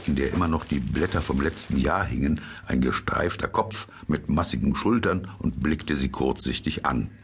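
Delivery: ordinary delivery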